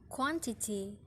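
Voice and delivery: "quantity" with QUAN stressed; first-syllable stress. Shown as a demonstration. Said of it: "first syllable is stressed".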